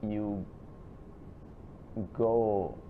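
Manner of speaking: usual speaking voice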